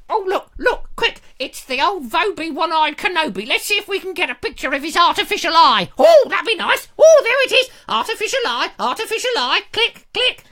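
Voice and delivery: excited fans with phones. Pretend. Fans photographing the host, high excited squeal. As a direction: squeal like fans spotting a celebrity.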